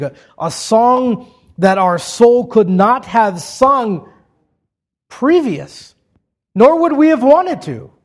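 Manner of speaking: high, ringing declamation building in pitch; impassioned preaching. Acted. Preacher exulting to the congregation that the soul now sings, impassioned. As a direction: preach it slowly and emphatically, drawing out the key words. Preaches at rising full force.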